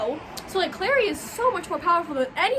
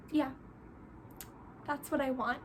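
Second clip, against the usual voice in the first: much softer, hollow resignation; defeated fake agreement; 3 semitones lower, 13 dB quieter than usual. Clary pretending to accept the brother-sister deal, much softer. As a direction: say it like you don't mean it, barely audible.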